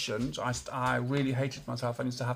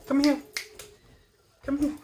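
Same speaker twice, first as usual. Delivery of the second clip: high, raised-voice summons; short loud command to a pet; calling a dog; 13 semitones higher, 7 dB louder than usual. Real, not acted. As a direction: call your dog over firmly.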